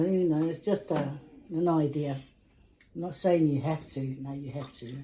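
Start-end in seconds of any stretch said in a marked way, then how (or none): 4.2-5.0 quiet joking aside. Real, not acted. Joking aside after "not saying.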